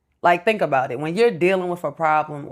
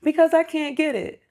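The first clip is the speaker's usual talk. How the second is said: put-on higher, whiny kid voice; imitating son; 10 semitones higher than usual. Mother mimics her son explaining his tears, in a higher voice. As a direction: whine it like a stuck child. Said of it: "Why are you crying?".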